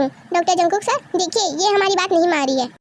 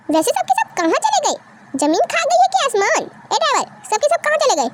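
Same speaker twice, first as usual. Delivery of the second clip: higher-pitched, baffled demand; exasperated bewilderment; 6 semitones higher than usual. Acted. Man asks driver where everyone went, exasperated. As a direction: wonder aloud in exasperation.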